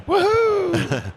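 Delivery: mock cheer. Sarcastic cheer over layoffs.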